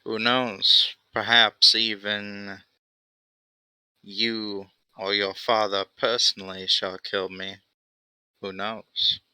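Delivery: laid-back goblin, amused and unbothered; lightly voiced goblin rogue. Goblin character's voice held.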